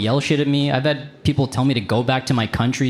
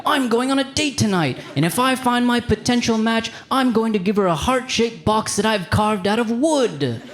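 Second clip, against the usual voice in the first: high, earnest imitation; lightly voiced sincere date-goer; Michael; 9 semitones higher than usual. Comic imitating Michael from the dating show, high and earnest. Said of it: "he'll be like".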